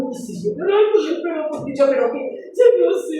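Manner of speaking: imitating crying daughter. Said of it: "Yo llorando por una mecha".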